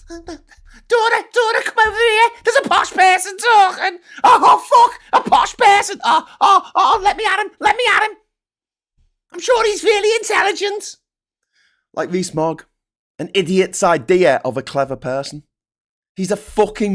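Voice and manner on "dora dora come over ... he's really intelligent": very high, frantic squeal; silly falsetto fawning, getting louder; mock starstruck fan. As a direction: shriek with hammed-up, drooling excitement. Acting fawning English people hearing posh.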